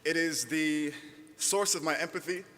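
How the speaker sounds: normal talking voice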